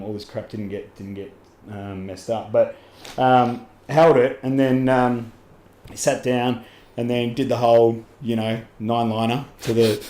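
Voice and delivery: usual speaking voice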